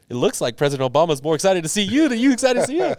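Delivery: high and loud, bursting with excitement; excited retelling. Retold or imitated. Guest retells the friends' joke, loud and excited. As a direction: belt out the joke you keep hearing.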